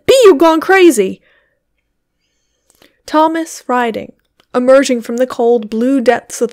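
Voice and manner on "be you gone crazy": scolding. A narrator's reading. Wife scolding husband.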